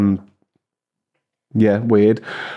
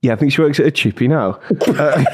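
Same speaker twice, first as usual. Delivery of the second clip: ordinary delivery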